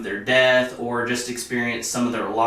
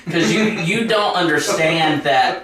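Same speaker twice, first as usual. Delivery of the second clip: laughing while explaining; 6 semitones higher, 5 dB louder than usual. Real, not acted. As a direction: talk through a laugh. Laughing at creepy memory.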